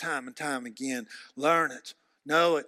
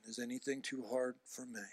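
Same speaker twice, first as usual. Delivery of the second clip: "Is anything too hard for me?" spoken much softer; quiet question; 10 dB quieter than usual. Retold or imitated. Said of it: "God always asking the question".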